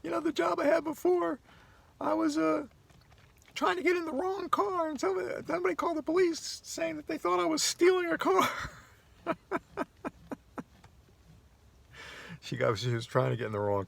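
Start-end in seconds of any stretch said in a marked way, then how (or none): 0.0-8.5 high, light imitation of the lady telling her story; the lady's voice. Vlogger quoting a woman in the parking lot, high mimicking voice. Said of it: "She goes". 9.2-10.1 high little giggle in her voice; imitated laugh. Vlogger mimicking the lady's laugh, high giggle. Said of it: "She goes".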